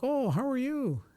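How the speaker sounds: very high, fake-sweet mocking voice; mocking the neighbor. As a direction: parody his phony friendliness in a squeaky falsetto. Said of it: "you come out like some fucking".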